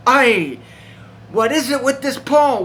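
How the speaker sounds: the demons throw up their hands at Paul; exasperated demon. Acted. Demons fed up with Paul, loud exasperated whine.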